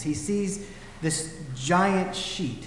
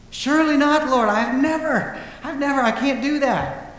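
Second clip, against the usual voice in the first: Peter protesting much louder and higher, almost shouting; 9 semitones higher, 5 dB louder than usual. Retold or imitated. Peter refusing the voice in a vision, pleading out loud. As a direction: cry it out, refusing God's command outright. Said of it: "Happens three times".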